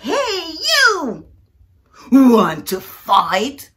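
loud, slow drawn-out dare; the grouchy ladybug dares the yellow jacket; challenging shout. Acted. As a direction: bark the challenge at a bigger bug.

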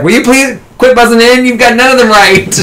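high, fed-up mock host; exasperated quiz host. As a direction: scold her like a weary quizmaster. Quiz host scolds the nerd.

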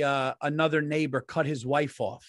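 ordinary delivery

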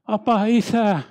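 loud, high cry to the Father; utmost cry. Retold or imitated. Preacher recounts a cry felt for the addicts, loud outcry. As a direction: cry out with piercing longing. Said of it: "äärimmäinen huuto".

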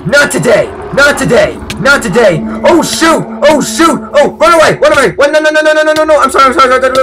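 high, frantic, very loud yells; panicked shouting. Real, not acted. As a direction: yell in a startled panic while fleeing. Startled by mob, fleeing in panic.

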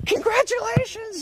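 high hushed witchy gasp; witch voice. Real, not acted. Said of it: "I sound like a witch".

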